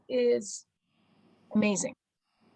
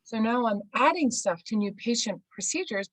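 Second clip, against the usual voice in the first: ordinary talk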